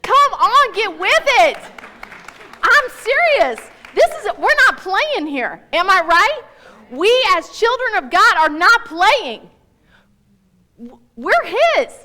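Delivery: loud, high preaching push; fired-up urging. Real, not acted. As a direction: rouse them, you mean every word.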